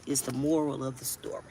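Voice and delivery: whispered aside. Real, not acted. To her viewers, a confiding whisper. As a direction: lean in and whisper it.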